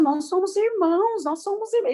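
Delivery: high-pitched, acted announcement; retold excited wonder. Instructor telling another little pupil, retold in high-pitched wonder. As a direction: imitate someone amazed, passing on news.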